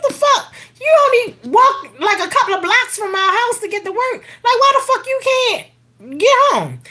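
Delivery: can't believe what she's asking; incredulous outburst. Retold or imitated. Mother retelling son's girlfriend's request, incredulous and half-laughing.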